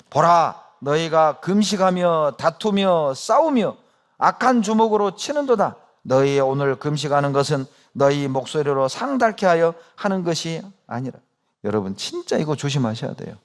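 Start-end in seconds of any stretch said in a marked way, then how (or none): 0.1-11.2 scripture reading together. Acted. Preacher leading the congregation in reading Isaiah, solemn. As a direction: read it aloud with the congregation. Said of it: "다 같이 읽습니다".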